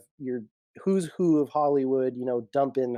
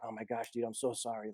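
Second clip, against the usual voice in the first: sheepish apology; 4 semitones lower, 13 dB quieter than usual. Retold or imitated. Dax apologizing quietly in retold scene.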